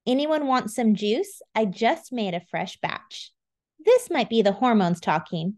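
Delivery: plain reading voice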